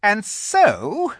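high, loud witch; resume imperiously in a high voice. A narrator's reading. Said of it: "continued the witch".

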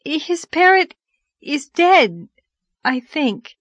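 high, halting, flustered answer; gasped, bewildered. A narrator's reading. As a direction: falter it out in gasped pieces. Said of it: "gasped poor Anne".

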